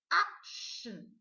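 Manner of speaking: "action" said slowly as AK-shun, clear shun ending; model word. Shown as a demonstration. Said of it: "we're learning to say action".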